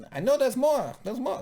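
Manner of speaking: high, pushy mock Joe Pesci. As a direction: press him like a jealous gangster. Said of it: "That's my Joe Pesci".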